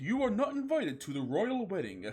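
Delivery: high, snooty letter voice; insulting letter. Acted. The royal family snubbing Garfield by letter, haughty. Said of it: "said the letter with insult".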